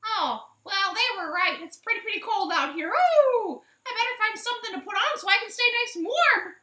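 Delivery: high, loud chirpy hen voice; bright hen shivering but cheerful, quick at the end; Minerva the chicken. Acted. Minerva alone in the snow, high and loud.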